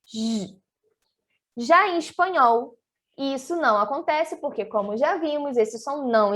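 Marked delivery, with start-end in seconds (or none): 0.0-0.6 "J" as a soft, held buzzing zh; Portuguese J sound. Shown as a demonstration. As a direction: hold the sound "J" alone, softly, like zh. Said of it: "ali que é esse sonzinho".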